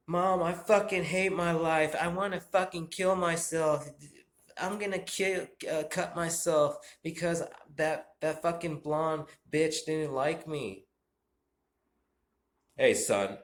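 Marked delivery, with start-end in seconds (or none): 0.1-10.9 put-on high, loud whiny teen son. Teen son ranting to his mom at dinner, high and whiny. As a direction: whine like an upset teenage son. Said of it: "My son, all right, the son".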